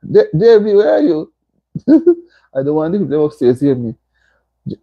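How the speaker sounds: ordinary talk, nothing marked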